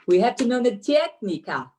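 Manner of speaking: put-on Russian or Ukrainian accent. Shown as a demonstration. Guest performs for host on request, imitated Russian accent. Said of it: "most Russian or Ukrainian thing you can say".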